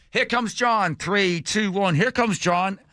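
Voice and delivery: high-pitched mock countdown call; imitating an upbeat walkie-talkie announcement; walkie-talkie announcer. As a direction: call it out like a jump operator on the radio. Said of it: "He's got this walkie-talkie".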